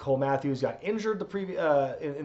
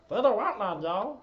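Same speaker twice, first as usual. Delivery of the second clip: higher, cartoon sidekick voice; Meatwad voice; 6 semitones higher than usual. Streamer does a wrestler's theme in a cartoon character's voice. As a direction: squeak it out like a little cartoon meatball. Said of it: "A J Styles theme is Meatwad".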